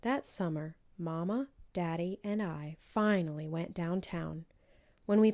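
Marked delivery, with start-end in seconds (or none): none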